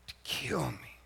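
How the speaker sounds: breathy whisper, barely voiced. Preacher voicing Jesus, whispered: I allowed you to kill me. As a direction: hush it like a secret.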